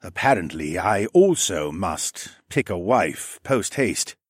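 slow, deliberate, formally voiced lizard man; formal raptor man. Raptor man sharing his uncle's order, slow and formal. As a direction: spell it out slowly, drily.

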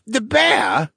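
high, amused question out of a chuckle; chuckling. A narrator's reading. Captive merman on the accomplice named, chuckling. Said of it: "he chuckled".

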